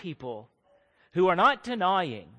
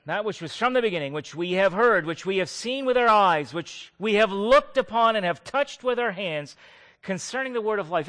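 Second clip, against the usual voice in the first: louder, quicker formal reading of the verse; scripture reading; 3 semitones higher, 7 dB louder than usual. Acted. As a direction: read the verse out to the congregation. Reads scripture verse aloud.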